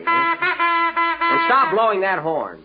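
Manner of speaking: tooting a party horn. Acted. Kenny tooting his horn at Jack. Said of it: "I'm practicing for the Rose Bowl".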